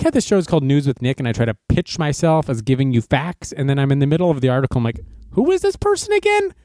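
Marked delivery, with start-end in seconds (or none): 5.3-6.5 very high squeaky confused question; high-pitched puzzled voice; confused squeak. Acted. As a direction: squeak it out like a lost cartoon. Confused mid-article aside.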